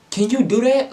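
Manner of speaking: can't believe she pranked him; shrill-high offended question; outraged disbelief. Retold or imitated.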